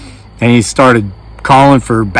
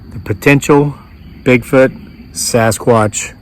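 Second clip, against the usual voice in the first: 1 slow show sign-off; 4 dB quieter, much slower than usual. Shown as a demonstration. Slow drawn-out show title sign-off.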